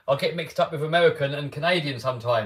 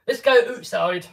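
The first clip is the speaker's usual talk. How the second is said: mock Canadian, higher, "outside" as "ootside"; imitated Canadian accent; 5 semitones higher, 4 dB louder, slower than usual. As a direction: put on a playful Canadian accent. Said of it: "that's how I can tell straight away".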